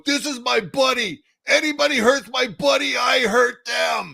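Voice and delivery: high, loud biker roar, slowing on the threat; protective biker shout. Retold or imitated. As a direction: bellow the threat to the whole bar. Biker protecting him in bar.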